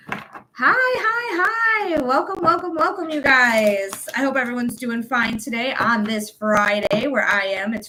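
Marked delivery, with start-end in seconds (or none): 0.1-4.1 high, drawn-out singsong welcome; Effie Trinket. Real, not acted. Host greeting the live viewers, high theatrical welcome. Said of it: "Sounded like Effie Trinket".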